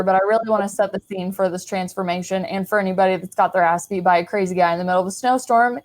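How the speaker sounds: ordinary delivery, nothing marked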